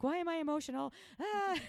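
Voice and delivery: high, loud mock wail; mock emotional outburst. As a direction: play up a tearful outburst, ending in a cry. Retells her own crying outburst.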